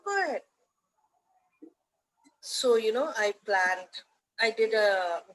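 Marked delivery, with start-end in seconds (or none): 0.0-0.4 high-pitched imitated approval; retold encouragement, pitched well up; encouraging go-ahead. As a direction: act out an eager green light. Acting out auntie's encouraging go-ahead.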